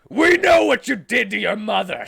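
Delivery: very loud, high shrieking accusation; crazy scream. Acted. Amy accuses Shadow of his crime, full scream. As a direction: scream it like a madwoman. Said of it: "screamed Amy like a crazy man".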